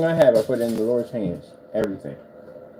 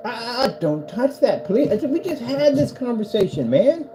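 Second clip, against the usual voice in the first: high scold; 10 semitones higher than usual. Real, not acted. Scolding cat.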